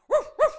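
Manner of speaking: loud, high yapping woof woof; mock dog bark. Host barking for the dog days joke, loud yap.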